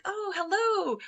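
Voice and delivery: high, surprised-sounding retold hello; polite greeting. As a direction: mimic a polite executive's high-pitched greeting. Acted greeting from the executive in the story.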